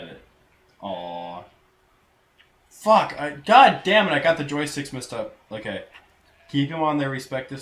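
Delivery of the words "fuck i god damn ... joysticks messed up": sudden loud frustrated curse; real rage shout at a fumble; angry outburst. Real, not acted. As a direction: blurt it out furious at the controls. Messed up the joysticks.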